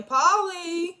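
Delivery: loud, high-pitched holler of a name; imitated drunken angry yell. Drunk Walter calling out Paulie, imitated angry yell. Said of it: "he yelling".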